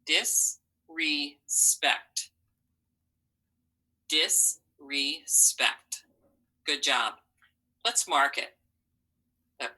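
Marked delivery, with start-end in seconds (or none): 0.0-6.0 Disrespect read very slowly, syllables scooped one by one; scooping the syllables. Shown as a demonstration. Teacher scoops Disrespect slowly with the students. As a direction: sweep through Disrespect as slowly as possible. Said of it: "Go ahead and scoop it".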